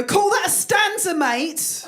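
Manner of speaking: high, jeering call from the back; mock pub-heckler yell. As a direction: heckle it across the room. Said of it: "stand in the shadows ... and go".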